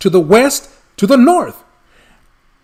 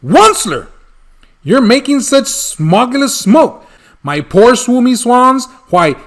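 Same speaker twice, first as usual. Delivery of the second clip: indignant croaking scold; 6 semitones higher than usual. Acted. Lorax scolding the Onceler over the smoke, croaky and high. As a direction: croak it out in protest. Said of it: "he cried with a cruffulous croak".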